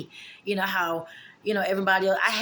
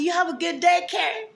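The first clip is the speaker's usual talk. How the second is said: much higher and louder sing-song goodbye; mock sweet farewell; 11 semitones higher, 5 dB louder than usual. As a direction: send her off with syrupy sarcasm. Retold mock farewell to Karen.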